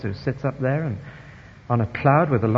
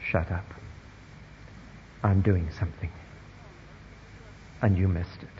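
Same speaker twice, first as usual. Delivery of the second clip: softer, low rebuke; quiet rebuke from God; 8 semitones lower, 11 dB quieter than usual. Retold or imitated. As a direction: hush them with calm authority. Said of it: "And God says".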